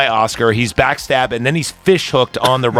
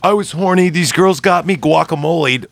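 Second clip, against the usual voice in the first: put-on higher, pleading drunk apology; whiny drunk guy; 6 semitones higher than usual. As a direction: beg like a sloppy drunk. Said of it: "And he's like".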